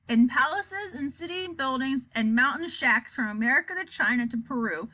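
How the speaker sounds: plain reading voice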